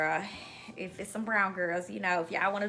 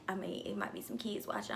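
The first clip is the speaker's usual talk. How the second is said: softer, whispered warning; whispered aside; 9 dB quieter than usual. Real, not acted. Aside about kids watching.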